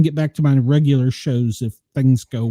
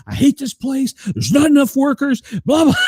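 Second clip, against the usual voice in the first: imitating angry manager; 8 semitones higher than usual. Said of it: "he was mad as hell".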